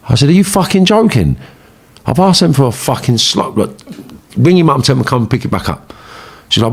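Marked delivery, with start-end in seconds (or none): 0.3-5.9 retold outrage, pitched high; acting out an offended, incredulous rant; indignant outburst. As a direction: reject the insult in raised pitch. Acted quarrel over insulting gift.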